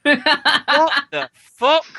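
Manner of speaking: loud, high, long-drawn incredulity; retold disbelief. Host retelling his reaction to the singer, loud disbelief. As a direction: stretch it out in mock bafflement. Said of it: "and I went".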